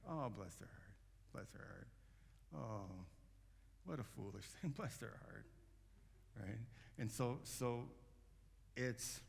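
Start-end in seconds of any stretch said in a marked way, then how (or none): 0.1-5.5 soft condescending pity. Retold or imitated. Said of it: "it comes with a smile".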